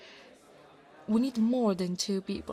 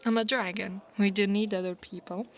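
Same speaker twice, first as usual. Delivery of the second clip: usual speaking voice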